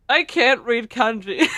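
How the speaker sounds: slow, loud, laughing through the words; cracking up while trying to read; giggling protest. Real, not acted. Mock panic at unreadable text.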